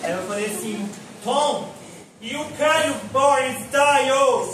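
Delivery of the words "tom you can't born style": loud, high, drawn-out shout; calling after someone. Retold or imitated. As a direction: holler after a man walking away. Calling after man walking away. (grito alto chamando de longe)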